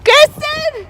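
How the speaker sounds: calling a name high and loud. Real, not acted. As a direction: shout across the car park. Calling out for friend.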